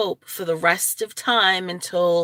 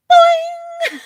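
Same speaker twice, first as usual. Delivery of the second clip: high, loud springy boing; cartoon boing; 10 dB louder than usual. Telling viewers her stray hair springs up, a cartoon boing. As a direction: bounce the word up like a spring.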